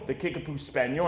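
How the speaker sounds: usual speaking voice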